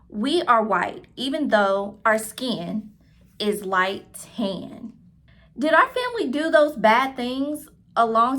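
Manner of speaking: clear, lively read-aloud voice for a class; reading to children. A narrator's reading. Teacher reading a picture book aloud to her class, lively storytelling. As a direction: tell the story warmly to young listeners.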